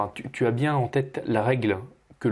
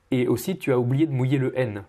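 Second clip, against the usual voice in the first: normal talking voice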